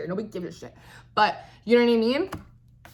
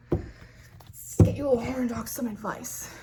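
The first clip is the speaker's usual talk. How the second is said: slow breathy whisper aside; slower than usual. Acted. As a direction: lean in and whisper the advice slowly. Teasing whisper aside to Leo.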